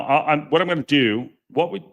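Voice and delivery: ordinary talk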